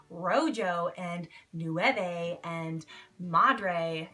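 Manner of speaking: put-on slow, Anglicized Spanish in an English accent; mock English accent. Imitating a smart-aleck in Spanish class, mock English accent. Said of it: "brutally mispronounce all the Spanish language with his English accent".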